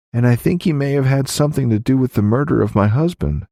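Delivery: a widow rushing to confess her fears; urgent widow. Acted. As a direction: Violet confiding in the sheriff. Violet voiced by the reader.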